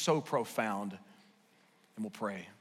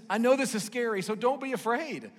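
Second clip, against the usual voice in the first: higher, bright and pressing; lightly voiced Jesus, coaxing; eager urging; 8 semitones higher, 3 dB louder than usual. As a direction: urge them warmly to let go. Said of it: "He says".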